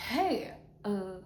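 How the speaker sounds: usual speaking voice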